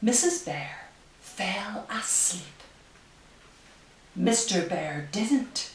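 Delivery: slow, hushed storybook reading; reading to children. Acted. Reads picture book aloud.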